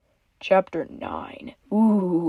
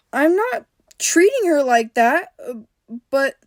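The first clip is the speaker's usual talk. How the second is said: flustered; 7 semitones higher than usual. A narrator's reading. Summer flustered.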